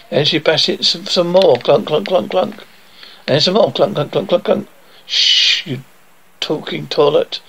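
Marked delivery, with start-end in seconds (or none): none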